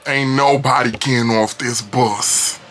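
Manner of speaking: put-on slow, slurred drunk threat; drawn-out menacing drunk; drunkie's voice. Shown as a demonstration. Drunk man on the bus threatening passengers, slow and slurred. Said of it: "give y'all a physical, a visual".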